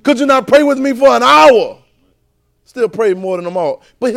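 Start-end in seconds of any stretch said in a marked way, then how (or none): 0.0-1.8 louder, higher plea; Jesus pleading with sleepy disciples, raised voice; pleading Jesus quote. Acted. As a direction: press the question home, loud and high.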